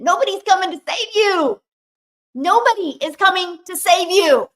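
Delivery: loud, high, urgent; forceful self-talk. Retold or imitated. To herself in the mirror, a loud urgent self-rebuke. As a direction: shout it at yourself in the mirror.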